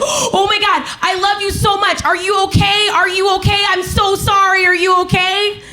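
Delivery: high, urgent mock panic; panicked. Boyfriend who just elbowed her by accident, acted high-pitched panic. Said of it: "he panics".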